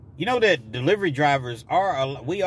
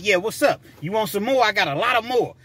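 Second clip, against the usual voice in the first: higher-pitched mock challenge; crazy talk to pet; 7 semitones higher than usual. Driver taunting a pet, crazy challenge. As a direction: taunt it like you're ready to fight. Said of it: "Now I'm talking crazy to a".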